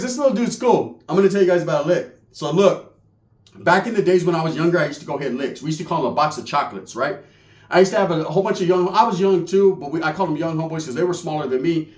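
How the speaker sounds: ordinary delivery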